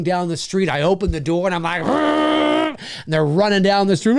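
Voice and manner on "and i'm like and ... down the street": ordinary talk, nothing marked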